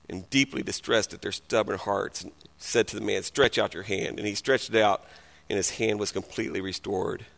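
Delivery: low, soft, grave narration; solemn scripture reading. Real, not acted. Preacher reading the congregation a grave passage, low and solemn. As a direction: read it low and hushed, letting it sink in.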